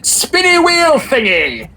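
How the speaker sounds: high, slow, gleeful shout; happy exclaim. Acted. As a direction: exclaim it with childlike glee. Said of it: "loudly and happily exclaiming".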